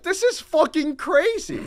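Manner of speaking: high, loud, drawn-out amazement; exasperated disbelief. Retold or imitated. Student recounting his reaction to the professor, exasperated disbelief. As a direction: stretch it out in disbelief.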